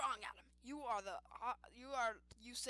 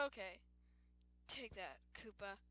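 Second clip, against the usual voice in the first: ordinary talk, nothing marked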